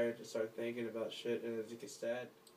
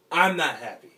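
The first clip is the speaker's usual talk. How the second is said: a desperate cry of pain; breaking into a loud anguished yell; anguished shout; 3 semitones higher, 12 dB louder than usual. Acted. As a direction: yell it out, pitch up and much louder. Repeats line, second take yelled.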